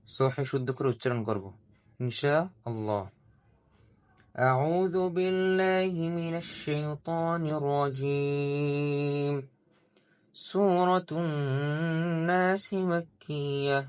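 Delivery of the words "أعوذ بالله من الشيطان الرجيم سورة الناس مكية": slow, melodic tajweed chant, pitched up; measured Quranic recitation. Acted. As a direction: recite slowly with long drawn vowels. Quran recitation opening a lesson.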